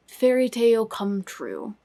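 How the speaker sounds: normal talking voice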